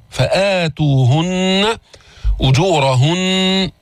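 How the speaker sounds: slow chanted "فآتوهن أجورهن", long "aa", hummed n; two-count madd. Shown as a demonstration. Teacher demonstrates the madd length, slow chanting of "فآتوهن أجورهن". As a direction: hold the آ in "فآتوهن" two beats. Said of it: "مقصور برواية حفص عن عاصم حركتين".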